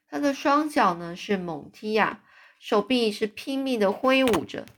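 ordinary delivery, nothing marked

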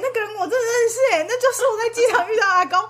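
high mocking laughing voice; mock the claim while laughing; laughing mockery. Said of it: "郭台铭". (笑着模仿嘲讽)

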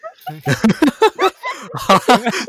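high squealing laugh; high burst of laughter. Real, not acted. Laughing at bird talk.

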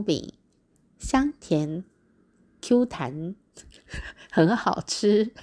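slow, measured train announcement; 列车长广播. Retold or imitated. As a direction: imitate a conductor's announcement, drawn out with amused chuckles. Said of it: "列车长就广播了，". (模仿列车长慢慢广播)

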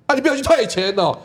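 mock-scold him like an annoyed wife; wife snapping at her husband over the refund; fierce scolding. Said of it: "他就很凶，在跟我讲".

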